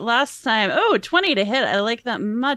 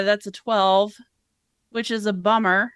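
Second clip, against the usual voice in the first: ordinary delivery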